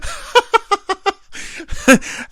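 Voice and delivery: high burst of laughing; loud laughter. Real, not acted. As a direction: crack up hard. Story punchline.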